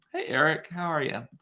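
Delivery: ordinary delivery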